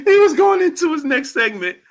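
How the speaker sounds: very high, squeaky with laughter; helpless laughter. Real, not acted. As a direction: struggle to tell it while cracking up. Cracking up while retelling.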